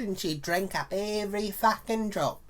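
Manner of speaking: usual speaking voice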